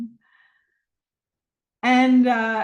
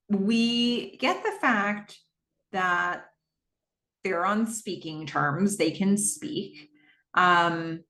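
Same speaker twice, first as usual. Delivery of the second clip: normal talking voice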